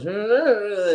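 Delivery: a quick hammed-up [name] "Hey". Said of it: "talking like [name]".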